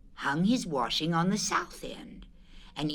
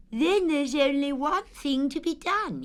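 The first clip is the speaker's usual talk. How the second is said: put-on high boyish voice; a light boyish voice; Christopher Robin's voice; 10 semitones higher, 4 dB louder than usual. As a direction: pitch up as a young boy.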